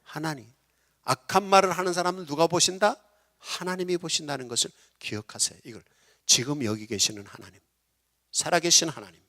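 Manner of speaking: ordinary delivery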